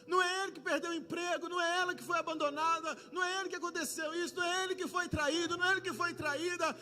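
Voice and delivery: loud, driving sermon build with shouted peaks; preaching at full force. Acted. Preacher stirring the church about neighbors, full force. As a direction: hammer each line harder to the congregation.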